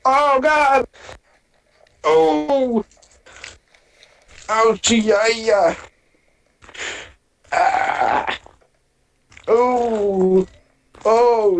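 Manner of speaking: high pained yelps, feet burning; ow-ing with each step on snow; walking on hot sand. Real, not acted. Walking barefoot on cold snow.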